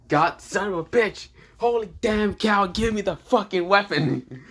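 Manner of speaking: high-pitched exasperated demand; angry cursing. Acted. Hero cursing as his weapon won't come, high angry demand. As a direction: curse in frustration, pitch jumping up.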